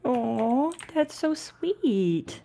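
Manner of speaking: high, slow, touched aww; sympathetic aww. Real, not acted. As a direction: melt at something cute. Golem offers villager a rose.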